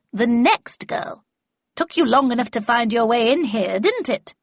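put-on loud, imperious old mistress; brusque Miss Havisham. Miss Havisham greets her new apprentice, brusque and loud. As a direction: chide a newcomer for being late.

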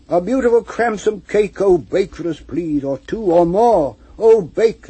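loud pleading chant; crowd crying out. A narrator's reading. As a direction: beg loudly for cake. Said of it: "they cried".

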